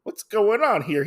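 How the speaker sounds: imitating a sudden grin; suddenly happy. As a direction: switch from grumpy to delighted. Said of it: "He's, like, very happy".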